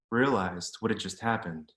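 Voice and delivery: usual speaking voice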